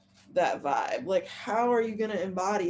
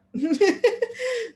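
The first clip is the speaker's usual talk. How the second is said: laugh; 11 semitones higher, 5 dB louder than usual. Real, not acted. Giggling at herself.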